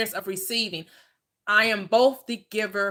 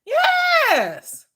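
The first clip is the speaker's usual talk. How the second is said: high, loud shout of yes; high triumphant yell; 9 dB louder than usual. Acted. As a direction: whoop in triumph. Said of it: "triumphant over free gas".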